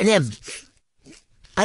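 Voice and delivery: talking around candy stick; biting on licorice; licorice stick in mouth. Real, not acted. Seen 2: Thin licorice held between teeth while talking, 1.1–2.4s, then removed.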